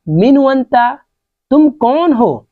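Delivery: ordinary delivery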